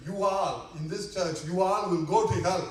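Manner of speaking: loud acted denunciation; irritated old preacher's outburst; irritated condemnation. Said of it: "kind of irritated".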